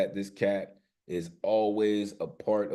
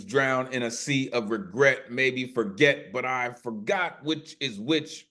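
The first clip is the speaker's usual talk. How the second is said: spoken word poetry; 3 semitones higher, 6 dB louder than usual. Acted. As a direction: perform it like a slam poet, hitting key words hard. Performs spoken word poem.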